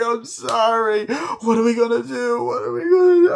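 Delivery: high, loud mock wailing; imitated crying. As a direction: act out a hysterical, tearful breakdown. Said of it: "And they start crying".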